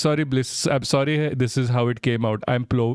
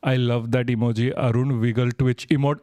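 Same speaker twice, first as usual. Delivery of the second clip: ordinary talk, nothing marked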